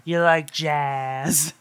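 slow, drawn-out odd voice; creepy movie quote. Retold or imitated. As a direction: say it slowly in a strange put-on voice. Quoting movie line in voice.

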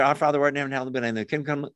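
rushed, mechanical recitation of Our Father; rattled off by rote. Shown as a demonstration. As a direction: gabble it like a memorized chant. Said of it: "pray that prayer repetitiously".

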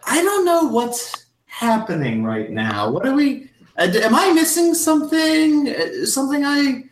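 very high, bewildered hostess voice; confused hostess. Acted. Mrs Garrison baffled at the table, high and confused. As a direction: fumble for what is going on, high and lost. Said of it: "she's kinda like".

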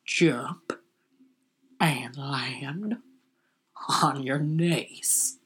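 low, whispered, very slow cop order; tough cop. Retold or imitated. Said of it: "here's my impression of a cop".

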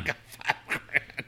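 slow hushed whisper of the boast; mock whisper. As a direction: breathe it out slowly like a secret. Host mocks the son's offer.

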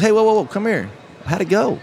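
high, bright call to her son; a mother's lifted hey, come here; high mock mom voice calling the boy over.

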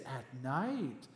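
soft, low, gentle reply; Pastor Timothy. Retold or imitated. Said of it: "he's so gentle when he talks".

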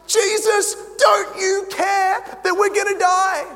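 desperate, shouted plea in the storm; panicked cry. Acted. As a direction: cry out like fishermen sure they'll drown. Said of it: "And they're like".

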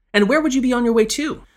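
a hint of Hainan brogue, questioning gently. A narrator's reading. Lady asking the youth his errand, light Hainan brogue. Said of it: "speaking in the local Hainan brogue".

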